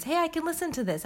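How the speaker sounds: bright inner voice. Retold or imitated. Quoting own inner reminder.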